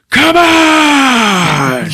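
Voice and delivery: high, loud drawn-out war scream; barbarian battle cry. Retold or imitated. Finn charges Phasma, loud high Conan battle cry. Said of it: "screams out, like fucking Conan the Barbarian".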